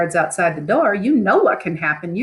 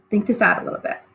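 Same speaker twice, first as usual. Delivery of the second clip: ordinary talk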